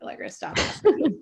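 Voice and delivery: high, loud retort breaking into laughter; mock exasperation. Client imitates therapist tired of her question, mock-exasperated and laughing. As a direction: laugh through an imitated fed-up reply.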